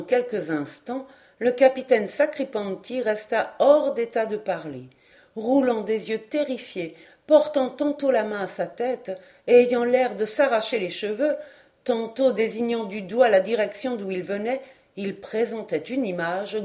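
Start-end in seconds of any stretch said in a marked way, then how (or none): none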